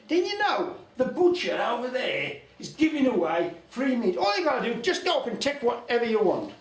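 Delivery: put-on very high, loud old woman urging; egging on. Old lady egging on the ne'er-do-well, high and loud.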